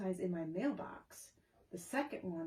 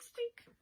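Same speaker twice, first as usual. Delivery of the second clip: high squeaky mouse squeak. Retold or imitated. Naming the mouse.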